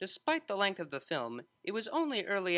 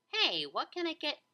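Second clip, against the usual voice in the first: much higher, bright-voiced server; cheery waitress; 11 semitones higher, 4 dB louder than usual. A narrator's reading. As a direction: greet the customers sunnily.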